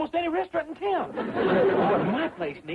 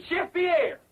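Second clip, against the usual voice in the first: higher-pitched summons; insistent call; 7 semitones higher than usual. Acted. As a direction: call out for the missing chef. Chef fails to appear, calls again.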